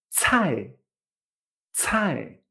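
cai as "tsʰai" with a strong puff of air; cai, breathy ts and falling tone; aspirated fourth-tone cai. Shown as a demonstration. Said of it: "con vibración y encima con aire".

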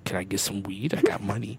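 low, very soft undertone; furtive plea. Retold or imitated. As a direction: negotiate on the sly. Covertly asking homeless man.

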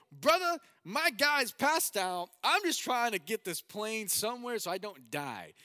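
high, loud desperate outburst; exasperated panic. Retold or imitated. Passenger snapping at headset voice mid-emergency, loud exasperated panic. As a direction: yell back at a useless helper, acted panic.

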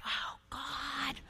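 breathless whispered awe; awed whisper. Retold or imitated. Preacher recalling the moment of surrender, awed whisper. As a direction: whisper it in wonder. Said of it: "whispered awe".

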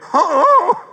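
high, loud comic yowl; hammed-up startled cat howl; startled cat cry. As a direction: imitate a cat's long high "oh". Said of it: "the cat go".

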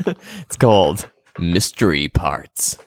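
drawn out, savouring the name; slow teasing reveal. Acted. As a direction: stretch it out like a big reveal.

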